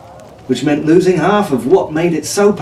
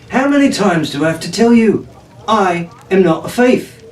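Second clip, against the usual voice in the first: protesting; 6 semitones higher than usual. A narrator's reading. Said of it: "Jack protested".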